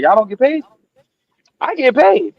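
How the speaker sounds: high, squeaky little robot; baby robot voice. Retold or imitated. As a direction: pitch way up like a tiny robot.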